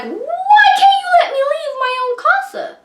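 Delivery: high, panicked near-shout with a cry in it; freaking out. Retold or imitated. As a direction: shriek it out in panic, voice cracking. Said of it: "And I'm freaking out".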